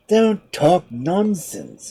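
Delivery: very slow, irritated shark; shark exclaiming. Acted. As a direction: drag it out, annoyed. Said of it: "exclaimed the shark".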